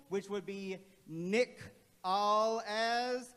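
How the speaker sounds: "Nick" slow with short i, then "All As"; closed syllable. Shown as a demonstration. Teacher sounding out first syllable for class. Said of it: "starts with a closed syllable".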